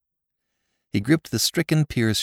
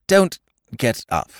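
smoothly; 6 semitones higher, 3 dB louder, slower than usual. A narrator's reading. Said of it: "urged Jimmy smoothly".

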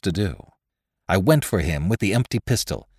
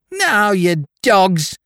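put-on much higher, slow drunken shrill; piercing sharp voice; 10 semitones higher, 5 dB louder, much slower than usual. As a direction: shriek it slurred like a drunk boy. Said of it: "he shrilled at us in maudlin tones".

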